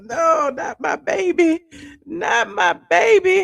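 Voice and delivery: high, drawn-out retold wail; hammed-up distressed pleading, stretched out; mock crying. Dog owner retells crying over his emergency surgery, mock wail. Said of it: "I was sitting up here crying".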